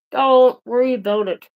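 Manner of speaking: loud, spaced-out telling-off; emphatic retort. Real, not acted. Vlogger repeating the answer to the nosy neighbour, emphatic retort. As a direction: hammer the brush-off home, loudly.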